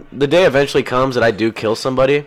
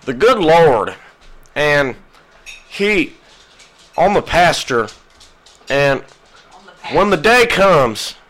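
radio preacher; 4 semitones higher, 3 dB louder, slower than usual. Retold or imitated. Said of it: "and it's like".

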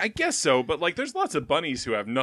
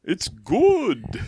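Santa voice; 7 semitones higher, 4 dB louder, much slower than usual. Pretend. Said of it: "is this Santa".

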